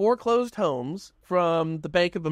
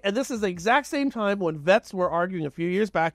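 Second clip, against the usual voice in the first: ordinary talk, nothing marked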